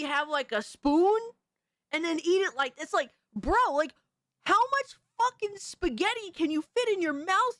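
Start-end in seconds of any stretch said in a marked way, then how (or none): 4.4-7.6 mock exasperation. Mock rant at spoon twirlers.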